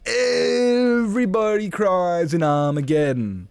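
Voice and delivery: very high, slow, drawn-out wail; hammed-up outcry. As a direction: stretch EVERYBODY long and high in mock outrage. Repeats the outcry, bigger and drawn out.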